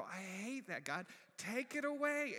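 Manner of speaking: high protest building to a loud cry; retold exasperated plea. Preacher begging God to remove his tears, exasperated. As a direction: wail it upward, hammed-up.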